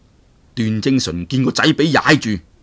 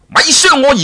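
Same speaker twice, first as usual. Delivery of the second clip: much higher, louder desperate shout; father's cry; 13 semitones higher, 5 dB louder than usual. Acted. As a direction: cry out to save his son. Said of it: "一個叫". (段正淳大叫救仔，聲調好高)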